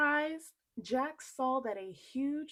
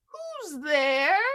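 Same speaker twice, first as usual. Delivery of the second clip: high, loud giantess call; 6 semitones higher, 12 dB louder than usual. Acted. Giantess calling at the door, high and loud. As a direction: boom out Who's there as a giantess.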